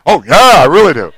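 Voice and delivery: loud, hammed-up eager yes; mock delight. Wife accepting whipped cream, loudly delighted. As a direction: burst out with acted delight.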